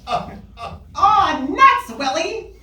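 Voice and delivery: high, put-on kid's whine; kid yell. A TV kid yells at Willie in a high voice. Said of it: "as a kid yells".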